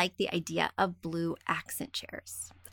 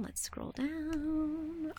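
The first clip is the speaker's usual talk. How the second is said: much softer, very slow murmur; mumbled under breath; 5 semitones higher, 11 dB quieter, much slower than usual. Real, not acted. Host murmurs to herself while scrolling the email.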